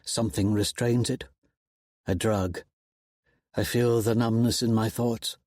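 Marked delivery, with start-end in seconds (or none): none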